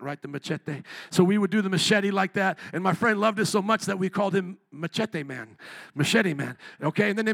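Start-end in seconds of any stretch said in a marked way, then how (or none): 0.2-0.8 low, soft machete with a Spanish-style "tay" ending; Spanish-style accent. Shown as a demonstration. Preacher showing how machete must be said, Spanish-style accent. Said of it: "you gotta say with the accent". 4.7-5.6 Machete with a Spanish-style "tay" ending; Spanish-style accent. Shown as a demonstration. Preacher recalling his friend's nickname, Spanish-style accent. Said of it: "you gotta say with the accent".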